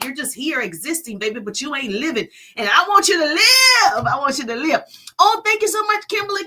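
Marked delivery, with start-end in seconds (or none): 2.6-4.8 loud, high cry of encouragement; impassioned urging. Real, not acted. 5.2-6.4 high, loud burst of gratitude; excited thanks. Real, not acted. Thanks for a live gift.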